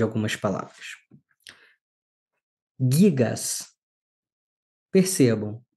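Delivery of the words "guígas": "Gigas" with extra emphasis on GI, falling after; stress on accented syllable. Shown as a demonstration. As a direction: hit the first syllable of "Gigas", hard g. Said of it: "eu tô dando ênfase maior à sílaba".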